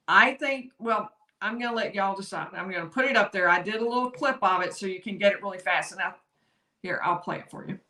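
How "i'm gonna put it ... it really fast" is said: usual speaking voice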